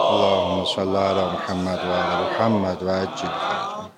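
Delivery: slow, devout invocation of blessings; prayerful recitation. Acted. Preacher leading the congregation in salawat, slow devout prayer voice. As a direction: intone it slowly, as a prayer.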